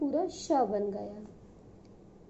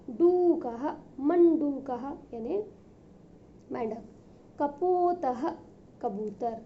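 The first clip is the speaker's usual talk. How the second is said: ordinary talk, nothing marked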